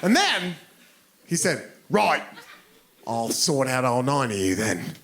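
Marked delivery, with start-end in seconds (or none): 1.9-4.9 put-on British accent. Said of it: "in that accent".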